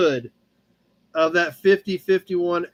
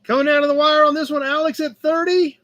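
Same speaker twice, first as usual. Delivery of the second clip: auctioneer closing call; 7 semitones higher than usual. Real, not acted.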